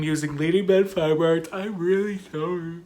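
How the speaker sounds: talking through a yawn; real yawn distorting the words. Real, not acted. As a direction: try to finish the sentence while yawning, apologetically. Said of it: "I don't know why I'm yawning".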